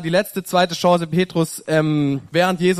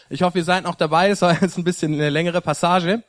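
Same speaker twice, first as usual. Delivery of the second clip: ordinary talk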